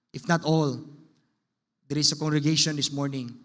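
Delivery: soft, low, steady pastor's prayer; quiet earnest prayer voice; closing prayer. Acted. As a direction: pray humbly over the congregation.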